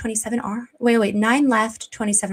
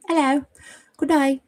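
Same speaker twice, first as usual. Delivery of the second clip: lightly put-on Aussie greeting, slightly raised pitch; attempted Australian accent; 4 semitones higher than usual. Streamer greeting an Aussie viewer, attempted Australian accent. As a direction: have a half-successful go at an Australian accent. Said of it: "I don't do a great one".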